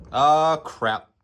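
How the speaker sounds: loud, annoyed "ah, crap"; frustrated outburst. Real, not acted. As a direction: blurt it out in sudden frustration. Realises he never hit record.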